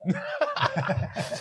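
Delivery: high-pitched laugh; real laugh. Real, not acted. Cracking up at the mustard story.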